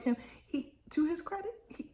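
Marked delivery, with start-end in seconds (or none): none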